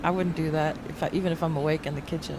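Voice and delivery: ordinary talk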